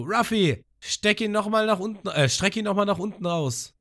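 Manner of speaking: high, eager character voice; Abis imitated. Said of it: "Und sie so". (hohe, drängende Stimme)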